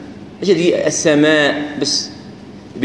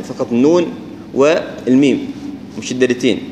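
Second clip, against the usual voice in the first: ordinary delivery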